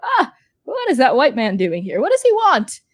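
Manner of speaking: a customer asking his friend what's going on; bemused regular. Acted. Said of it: "you hear Wang Hai go".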